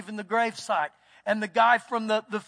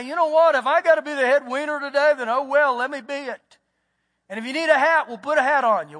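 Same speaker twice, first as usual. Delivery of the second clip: emphatic preaching; 3 semitones higher, 5 dB louder than usual. Acted. Preaching at full force.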